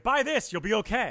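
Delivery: high, loud fake sales pitch; mock ad pitch. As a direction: sell it like a cheesy ad.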